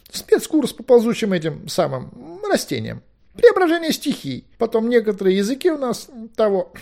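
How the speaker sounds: high, loud, fussy figure; evasive Merlin. A narrator's reading. Merlin lists suspect subjects to Owen, evasively. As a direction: hedge in a high, hesitant voice.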